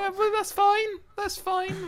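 squeaky high voice; high-pitched. Real, not acted. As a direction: pitch way up, brushing it off.

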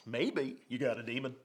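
quiet Texas preacher line; a soft echo of the Texas preacher; light Texas accent. Retold or imitated. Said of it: "I'm gonna do my Texas accent here".

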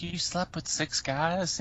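slow, soft, high disbelief; mock shocked question. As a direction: ask it in playful shock.

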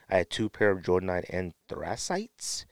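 usual speaking voice

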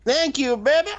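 very high cartoonish squeak; squeaky high voice. Answering in squeaky voice.

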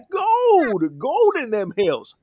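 put-on high, cackling old-timer prospector crying out gold; crazy old prospector. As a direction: shout it like a wild-eyed gold miner in a Western. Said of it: "there was a crazy prospector".

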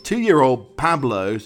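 slow, loud and drawn out in delight; enthusiastic praise. Real, not acted. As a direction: stretch it out, thrilled. Praising the goat.

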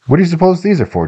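put-on quick, high-pitched boy's question; lightly voiced inquisitive boy; curious boy. A narrator's reading. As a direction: ask it fast and high like a kid. Boy asks about the gifts.

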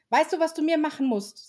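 put-on a light eager child. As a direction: ask like a keen little kid. Eldest elf child asks.

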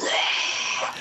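hushed, voiceless mock roar; crowd cheer. Legion answers the actor's call, softly imitated roar. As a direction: imitate a crowd's roar under your breath. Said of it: "They're like".

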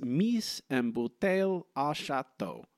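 lightly done French accent, slow; a hint of French, slowly read. Shown as a demonstration. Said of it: "I apologize for my terrible French accent".

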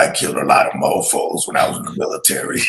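put-on low, rough, fast old grandpa growl; raspy old man voice. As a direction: rasp it out like a crusty old veteran. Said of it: "that raspy voice".

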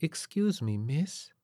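soft, airy, high dreamy singer; breathy New Age waif; waifish voice. A narrator's reading. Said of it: "lovely waifish voice".

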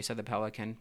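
ordinary delivery, nothing marked

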